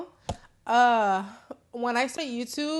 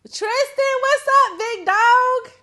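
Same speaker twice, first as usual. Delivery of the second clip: excited greeting; 13 dB louder, much slower than usual. Real, not acted. Caller Tristan comes on line.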